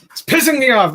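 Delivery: very high, loud squeal of complaint; mock outrage. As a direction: squeal it in hammed-up frustration. Mock rant at Google Chrome.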